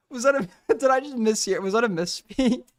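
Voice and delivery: ordinary talk, nothing marked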